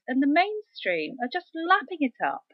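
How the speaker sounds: ordinary talk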